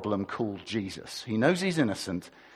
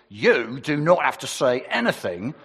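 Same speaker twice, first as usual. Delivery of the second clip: louder, formal police caution; officer cautioning the arrested man, louder and formal; 4 semitones higher, 8 dB louder than usual. Acted. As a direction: recite it like a copper making an arrest. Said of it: "I've got my best police voice".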